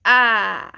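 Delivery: loud, open "A" from further back; open back vowel. Shown as a demonstration. Teacher modelling ä sound, projected. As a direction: open the mouth on "A". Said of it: "you open your mouth a bit more".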